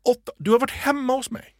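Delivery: högt tonläge, härmad förnärmad indignation; lite arg. Retold or imitated. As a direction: spela upp henne lätt irriterad och misstrogen. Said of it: "Och så blir hon lite arg". (härmar en lite arg kvinna)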